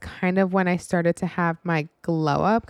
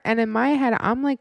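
ordinary delivery

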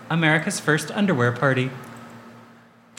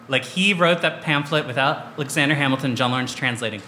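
normal talking voice

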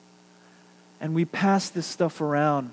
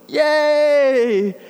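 high, louder cheer; kid's cheer; 9 semitones higher, 6 dB louder than usual. Retold or imitated. The confused boy cheers with the team, high and loud. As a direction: join in with a loud happy yell.

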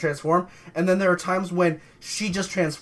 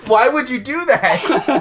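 laughing shock; 7 semitones higher, 5 dB louder, slower than usual. Real, not acted.

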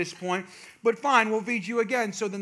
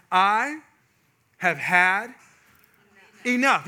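loud, word by word with long pauses; mock the wife's angry last-straw tone; emphatic, drawn-out exasperation, imitated; imitated fed-up wife; 7 dB louder, much slower than usual. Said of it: "and angrier, and the tone starts to change".

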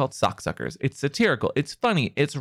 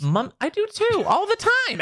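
indignant protest. Real, not acted. Protesting partner's teasing complaint.

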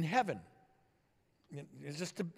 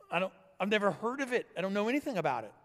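louder, higher-pitched protest of ignorance; clueless protest; 5 semitones higher, 4 dB louder, faster than usual. Acted. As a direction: protest to an interrogator, baffled. Thief protests ignorance to questioning angel.

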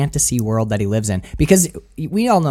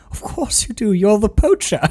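higher, amused posh Withnail voice; mock Withnail; 7 semitones higher than usual. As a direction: play the knowing accuser, a touch of laughter. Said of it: "And Withnail goes".

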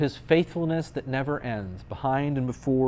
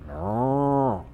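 long, drawn-out cow noise; imitated farm-animal moo; baby cow moo. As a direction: moo like a calf from a picture book. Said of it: "Baby cow says".

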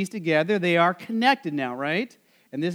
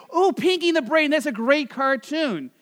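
higher-pitched imitation of a delighted fan; excited recognition; 6 semitones higher than usual. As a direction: exclaim it like an imitated passerby spotting a favourite. Acts out people recognizing the shirt.

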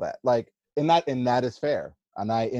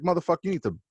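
usual speaking voice

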